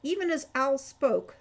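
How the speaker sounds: usual speaking voice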